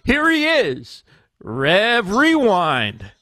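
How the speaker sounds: slow hype intro, swooping up on key words; show intro announcer. Pretend. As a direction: stretch out the host's name like an announcer.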